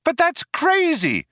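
very high-pitched outcry; cried out. A narrator's reading. Trillian protests the cop's threat, cried out. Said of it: "cried Trillian".

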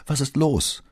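high-pitched, anxious question; deeply worried. A narrator's reading. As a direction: ask in great concern, voice lifted. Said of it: "fragte Signorina Elettra in höchster Sorge".

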